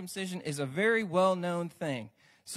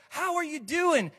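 higher, bright-sounding hello; mock cheerful greeting; 8 semitones higher, 5 dB louder than usual. Preacher mimicking the church greeting. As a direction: ask it like a fake-friendly churchgoer.

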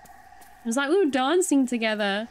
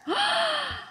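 sharp high gasp of delight; real excited intake of breath. Real, not acted. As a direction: gasp in happy surprise. Delighted at getting new item.

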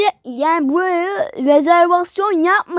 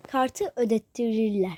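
lower, softer repeat of "Kartı ödettireyim"; quieter repeat; 5 semitones lower, 8 dB quieter than usual. Pretend. Repeating to himself in a low voice while paying by card. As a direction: say "Kartı ödettireyim" again, dropping the voice. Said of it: "Ee, şey".

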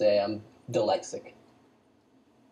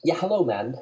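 high, louder, bad Jamaican accent; mock Jamaican accent; 4 semitones higher, 5 dB louder than usual. Joking with a friend, badly faked Jamaican accent. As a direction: toss out a dubious Jamaican accent. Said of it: "I don't know, Jamaican".

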